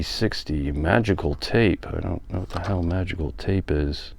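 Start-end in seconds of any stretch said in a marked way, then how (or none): none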